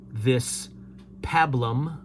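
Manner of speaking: usual speaking voice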